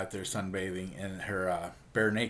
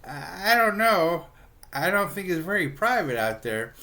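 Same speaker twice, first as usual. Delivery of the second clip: worried mom; 8 semitones higher, 5 dB louder than usual. Retold or imitated. Mom objects to backyard sunbathing, high and worried. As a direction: imitate a doubtful mom, pitched well up.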